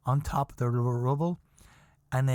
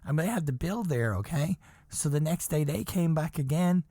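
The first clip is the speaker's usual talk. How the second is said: ordinary delivery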